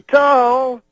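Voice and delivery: Cosby working the stadium, high loud call; crowd-baiting shout. Retold or imitated.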